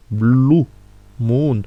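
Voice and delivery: "Blue moon" with a drawn-out "ou" sound; long oo. Shown as a demonstration. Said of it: "un ou un tout petit peu long". ("Blue moon" avec un ou allongé)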